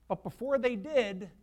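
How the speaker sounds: ordinary talk, nothing marked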